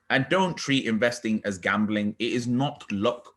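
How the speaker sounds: ordinary talk, nothing marked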